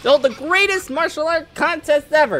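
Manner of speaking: high-pitched cartoon dub; excitable game character. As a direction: play it high and worked up.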